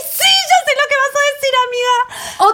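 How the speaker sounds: high hammed-up whine at her friend; mock whine. Whining mock reaction to friend.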